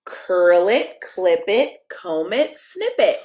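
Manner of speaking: lively picture-book read-aloud, sing-song rhymes; reading to children. Acted. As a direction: read it like a bedtime story for kids.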